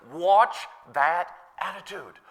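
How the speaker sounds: slow, spaced-out caution; weighty retold warning. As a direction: draw out each word. Repeating the apostle's warning.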